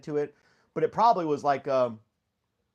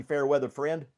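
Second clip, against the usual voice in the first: ordinary talk, nothing marked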